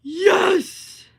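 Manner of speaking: loud, high triumphant yell; excited cheer. Real, not acted. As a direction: cheer in sudden victory. Celebrates finding the poll feature.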